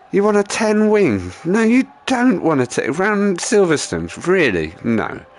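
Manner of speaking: loud, high-pitched scornful disbelief; exasperated mock outrage, voice shooting up; incredulous rant. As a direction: argue back loudly, stretching the key words. Ranting back at YouTuber's bad advice.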